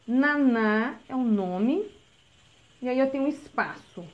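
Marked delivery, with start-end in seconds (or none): 0.1-1.9 put-on very slow, spelling out each word for a child; drawn out as if dictating; slow dictation. Shown as a demonstration. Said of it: "Então".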